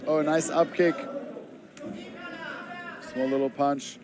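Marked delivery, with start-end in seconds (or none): none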